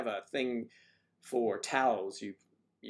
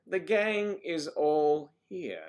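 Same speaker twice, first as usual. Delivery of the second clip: slow announcing; 6 semitones higher, 7 dB louder, much slower than usual. Real, not acted. Greeting arriving viewers.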